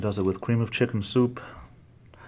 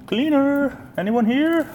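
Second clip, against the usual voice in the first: much higher and louder call; 6 dB louder, slower than usual. Real, not acted. Checking the empty room, calling out loudly.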